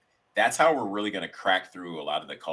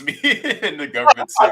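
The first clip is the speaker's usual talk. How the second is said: laughing through the words; 13 dB louder than usual. Real, not acted. Guest laughing as he recalls the sketch, laughing. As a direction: chuckle it out. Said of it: "laughing while telling".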